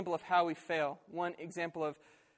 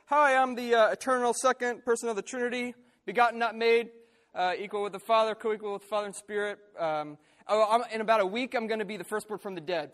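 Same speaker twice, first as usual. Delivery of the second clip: higher, louder, smug and dismissive; stuck-up mock Jesus; 4 semitones higher, 6 dB louder than usual. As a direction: play a haughty know-it-all brushing them off. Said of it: "he could have stuck his nose up and said".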